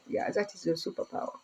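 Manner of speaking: fast, soft whispered voice of God; God's reply. Retold or imitated. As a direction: whisper the answer like a gentle God. Voicing God's answer.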